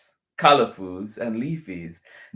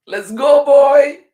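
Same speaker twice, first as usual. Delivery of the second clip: very high, loud shout to a dog; high-pitched, eager holler; calling out; 14 dB louder than usual. A narrator's reading. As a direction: call your dog from a distance. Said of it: "Little calls out".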